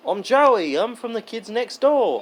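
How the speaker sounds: put-on lightly done Australian accent; imitating Australian accent. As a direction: mimic Joey with an Australian accent. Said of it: "That's not how he talked".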